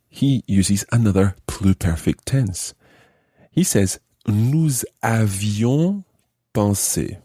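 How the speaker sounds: ordinary talk, nothing marked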